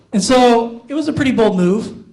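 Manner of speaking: normal talking voice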